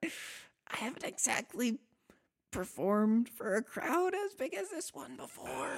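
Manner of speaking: put-on high, stammering, jittery young bard; nervous high bard voice. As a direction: squeak it out, caught off guard.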